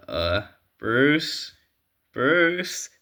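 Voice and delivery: tentative, rising call of the name; a sidekick trying to get the boss to look; Dick calling Bruce. Acted.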